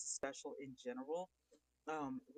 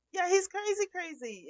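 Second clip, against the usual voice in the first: excited; 13 dB louder than usual. Real, not acted. Reacting to chat about centipede.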